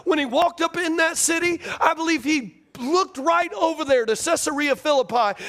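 high, driving pulpit fervor; preaching. Real, not acted. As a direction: preach it in urgent, rolling phrases. Preaching at full force.

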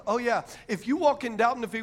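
ordinary talk

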